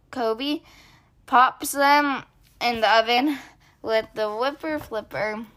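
usual speaking voice

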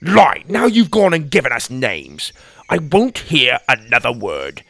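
loud angry rejection; indignant. Acted. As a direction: snap back at the insulting claim. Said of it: "Da recoiled".

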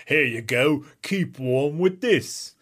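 bad British accent for a kindly passerby; terrible put-on British accent. Kindly passerby gives orphans a Switch, bad British accent. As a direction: ham up a poor British accent, slowing down. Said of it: "that's a terrible accent".

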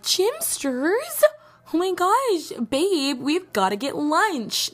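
high, slow, sugary squeal; fake sweet ex. Viewer sliding into the ex's DMs, high sugary. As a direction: coo it up high like a fake friend. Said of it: "I don't want you sliding up like".

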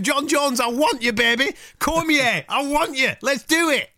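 high, insistent challenge; acted fight call-out. Host showing the fighter how to challenge John Jones, challenging. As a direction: play a fighter calling out a rival at high pitch.

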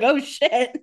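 sudden loud high curse; surprised. Retold or imitated. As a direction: blurt it out in shock. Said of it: "He's like".

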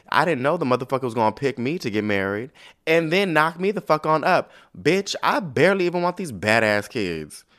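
hammed-up outburst of a fed-up queen; mock angry rant. Alicent venting at Rhaenyra, loud mock rant.

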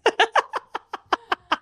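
giddy high-pitched laugh. Real, not acted. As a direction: burst into delighted laughter. Shared laughter.

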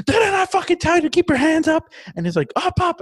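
high, loud mock screaming of commands; imitated cop yelling.

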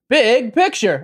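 segment announcer voice. Acted. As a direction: call the segment title.

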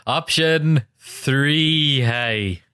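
broad, drawn-out Donegal accent, very slow; heavy Donegal accent. Shown as a demonstration. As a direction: lay the Donegal accent on thick. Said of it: "your stupid Donegal accent".

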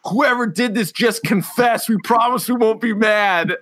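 high, slow wheedling plea; a hammed-up pleading voice; mock innocent plea. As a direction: coax them like a fake-innocent man. Retelling hot dog man's plea.

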